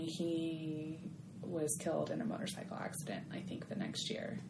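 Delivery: whispered, voice dropped right down; hushed whisper. Real, not acted. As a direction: breathe it out as a near-silent whisper. Sad news told hushed.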